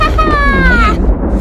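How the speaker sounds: loud, high witch screech. Pretend. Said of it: "very bad witch voice".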